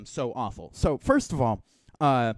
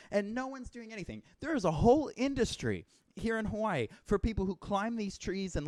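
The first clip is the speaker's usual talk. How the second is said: ordinary delivery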